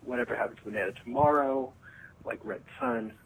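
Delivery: ordinary delivery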